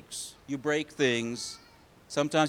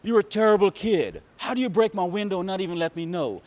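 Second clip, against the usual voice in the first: loud, high angry telling-off; acted indignant neighbor, raised voice; scolding neighbor; 6 semitones higher, 6 dB louder than usual. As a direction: lay into the kid, getting quicker. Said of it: "Mister What's-His-Name says".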